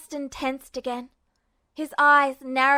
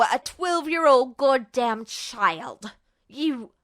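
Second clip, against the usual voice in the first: Justin's unhinged rant. Acted. Justin rants at Ed and Roy, bitter and unstable.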